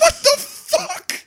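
high, slow, laughing what the fuck; laughing disbelief. Retold or imitated. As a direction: act out their shocked laughter. Friends reacting in disbelief.

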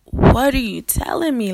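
stammering, incredulous outburst; shocked. Retold or imitated. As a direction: re-enact stunned protest to the boss. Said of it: "I was in complete and total shock".

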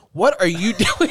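loud and laughing; laughing mock shout. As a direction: yell it through a laugh. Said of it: "he said".

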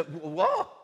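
high, baffled "What?". Retold or imitated. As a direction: sound completely thrown by the reply. Coworkers' baffled reply.

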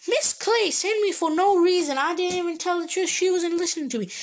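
high, fast indignant complaint; upset complaint. Retold or imitated. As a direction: pour out the unfairness to the head teacher, high and quick. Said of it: "I said".

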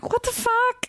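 high, thin mock shock; squeaky what-the-hell; stunned disbelief. Recapping shocked reaction to second rider.

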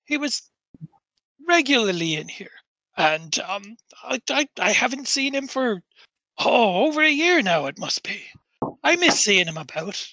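put-on lilting Norfolk-accented old acquaintance, reminiscing. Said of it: "a Norfolk fucking accent".

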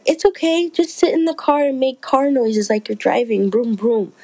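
playful coworker voice. Retold or imitated. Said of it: "And she's like".

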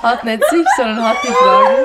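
hoch, laut lachend. Real, not acted. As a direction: kriegt sich vor Lachen nicht ein. Lacht sich kaputt. (vor Lachen quietschend)

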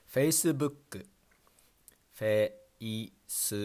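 "Facebook" as fe-i-su-bu-k-ku, slow; フェイスブック; Japanese pronunciation. Shown as a demonstration. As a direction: stretch "Facebook" into Japanese syllables. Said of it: "Next one is Facebook".

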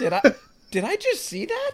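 high, loud disbelief; startled neighbor. Pretend. Imagined neighbor at the mailbox, high and startled. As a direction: neighbor seeing a fireball run by.

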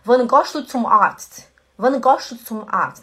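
Swiss German gehst said as goscht; slow Swiss German accent with gohst. Shown as a demonstration. Teacher contrasting the Swiss German way with standard German. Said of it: "O suíço alemão falaria assim".

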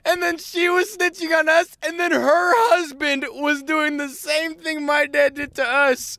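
high, loud and excited; practically shouting the twist; excited retelling. Real, not acted. Telling a friend the best part, high and loud.

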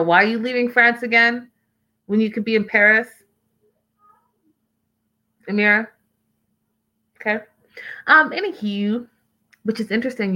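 ordinary delivery

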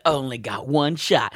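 slow, low, soft mock rap line. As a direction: mutter a rap lyric slowly, mock-solemn. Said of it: "you're doing [name] in the corner".

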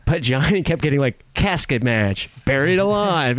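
ordinary delivery

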